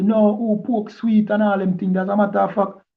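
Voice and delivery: high-pitched mock objection; protesting retort. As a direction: act out a brother refusing the advice. Acting out the brother's refusal.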